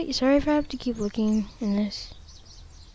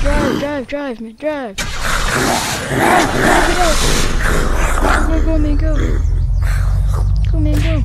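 shouting urgently; 6 semitones higher, 9 dB louder, slower than usual. Real, not acted. Urging the car on.

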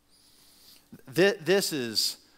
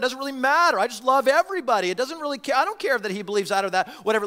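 higher, fast, breezy mock voice; mocking a careless believer; 6 semitones higher, much faster than usual. Preacher mimicking churchgoers who shrug off doctrine, higher and fast. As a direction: toss it off like nothing matters. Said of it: "people that talk like this".

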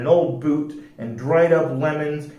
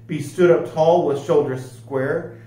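plain reading voice

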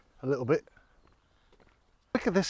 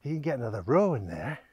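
thinking aloud; 2 semitones lower, 6 dB quieter than usual. Retold or imitated. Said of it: "And I'm thinking".

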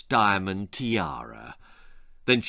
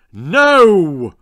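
loud, booming no; 4 semitones higher, 11 dB louder than usual. Acted. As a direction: boom it across the doorstep. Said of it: "boomed Angela's mother".

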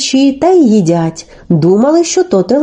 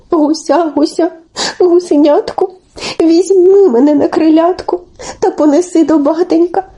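put-on raised, coaxing child-like voice; pleading Telesyk; 5 semitones higher than usual. Telesyk begs the lone gosling for rescue, high and pleading. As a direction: beg gently in a small high voice.

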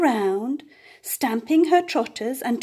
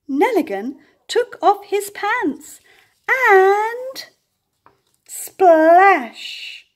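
higher and louder, rushing to a splash; excited build to splash; 9 semitones higher, 6 dB louder, much slower than usual. A narrator's reading. Farmer jumps in climax.

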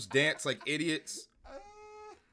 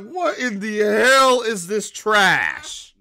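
high, loud and slow disbelieving shout; loud mock outrage for Joe; excited yell; 9 semitones higher, 16 dB louder, much slower than usual. Real, not acted. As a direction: shout it out, stretched in delight. Voicing Joe, still excited.

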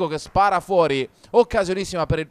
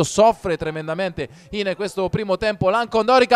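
ordinary delivery

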